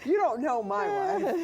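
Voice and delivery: laughing through the quote. Retold or imitated. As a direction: giggle while quoting. Laughing repeat of husband's quote.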